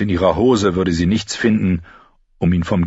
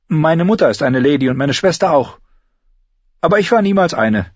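put-on higher girl's voice, defiant; Arya's voice; 9 semitones higher, 4 dB louder than usual. Girl denies being a lady, higher defiant voice. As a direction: insist she was never a lady.

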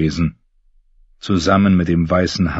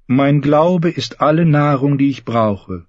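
put-on higher, slow, devout young knight; Lancel; 8 semitones higher than usual. Lancel preaching to one-handed James, slow and devout. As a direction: preach the gods' gifts gently.

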